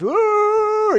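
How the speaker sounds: very high, drawn-out howl; imitated dog howl. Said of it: "And the dog goes".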